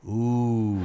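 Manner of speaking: low, drawn-out worried ooh; ominous ooh. Retold or imitated. Doctor with a clipboard reacting to his tests, low and drawn out. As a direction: sound like bad news is coming.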